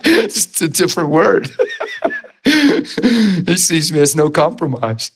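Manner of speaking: amused, laughing delivery. Real, not acted. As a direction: laugh it out while making the point. Laughing at his own point.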